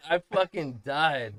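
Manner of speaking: slow words through leftover laughter; real laughing. Real, not acted. Dad recalling how he died laughing, laughing as he speaks.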